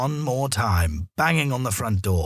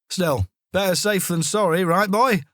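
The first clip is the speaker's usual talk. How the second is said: friendly to dog; 8 semitones higher, 3 dB louder than usual. A narrator's reading. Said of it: "he said".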